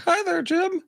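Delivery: high puppet voice, lips still; squeaky ventriloquist dummy voice; talking with mouth closed. Pretend. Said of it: "Are you trying to talk without moving your mouth?".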